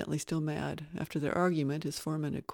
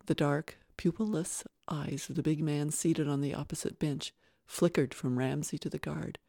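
ordinary delivery, nothing marked

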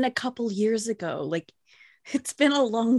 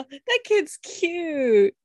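slow, high, tender coo; 7 semitones higher, 4 dB louder, much slower than usual. Real, not acted. Cooing over cute kid photo.

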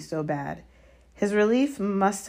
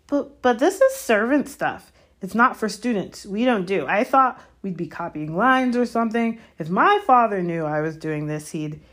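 loud, higher stammering objection; indignant protest; 5 semitones higher, 6 dB louder than usual. A narrator's reading. Malfoy objecting to servant stuff, indignant. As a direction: complain in affront, cut off at the end.

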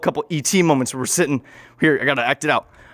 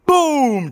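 gunshot boom; 11 semitones higher than usual. Acted. Gunshot in acted story.

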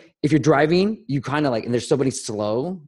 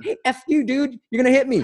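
high, hammed-up angry "F you" at the driver; mock hostile yell; 13 semitones higher than usual. What he avoided saying to the driver, mock rage. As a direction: play the road-rage version for laughs. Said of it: "not like".

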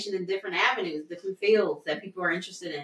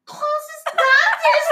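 high, teary, slow; 13 semitones higher, 4 dB louder, slower than usual. Retold or imitated. Quoting herself moved by mom's praise, teary and high. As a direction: choke up on it. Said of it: "I was just like".

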